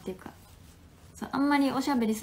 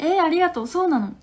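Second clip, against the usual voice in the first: excited thanks; 6 semitones higher, 7 dB louder, faster than usual. Real, not acted. News of Yahoo article.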